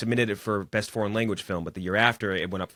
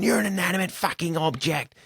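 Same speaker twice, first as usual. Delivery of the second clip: high-pitched mock scream, not full volume; mock yelling down a phone; imitated screaming; 10 semitones higher than usual. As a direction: quote an outraged movie rant, pitched up high. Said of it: "Him screaming at the phone".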